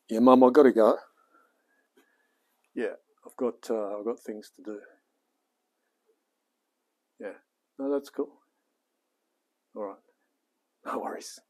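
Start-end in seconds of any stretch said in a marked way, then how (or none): 0.1-1.0 louder, firm sign-off; louder to Mum. Real, not acted. Telling Mum on the phone he has to go, loudly. 9.7-11.4 whisper. Real, not acted. Unvoiced under breath.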